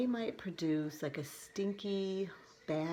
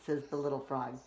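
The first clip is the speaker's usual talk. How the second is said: usual speaking voice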